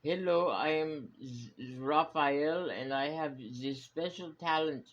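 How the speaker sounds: put-on half-hearted French accent introducing himself. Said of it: "how do you do a French accent?".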